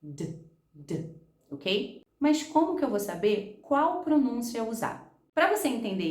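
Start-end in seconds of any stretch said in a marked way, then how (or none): 0.0-1.1 "D, D" said as a short voiced d, softly; teacher models a lone voiced "D"; ED as bare D. Shown as a demonstration. Teacher shows students the D ending. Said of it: "somente o D".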